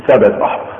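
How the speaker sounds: ordinary talk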